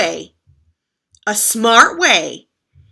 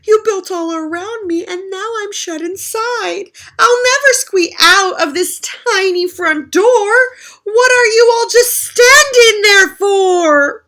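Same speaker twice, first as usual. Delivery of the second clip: high, wailing complaint; stuck and pleading; desperate cry; 10 semitones higher, 7 dB louder than usual. Acted. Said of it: "muffled voice cried".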